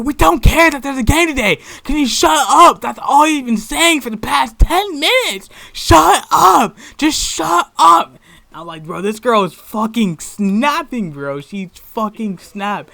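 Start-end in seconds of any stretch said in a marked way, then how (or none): 0.0-8.2 high, loud enraged yelling; shrieked outburst, stretched at the end; losing it. Retold or imitated. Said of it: "she just lost her shit". 8.8-12.9 amazed. Retold or imitated. His amazed reaction to outburst.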